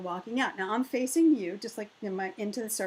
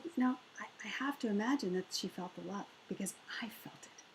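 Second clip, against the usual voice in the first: soft, breathy whisper; 10 dB quieter, faster than usual. Retold or imitated. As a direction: whisper it as a tender secret. Hushed reply to stunned sister.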